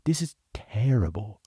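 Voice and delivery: hushed, unvoiced whisper of dismay; whispered aside. Watching a bad promo, he whispers in dismay. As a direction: breathe it out in a horrified whisper. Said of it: "I was like".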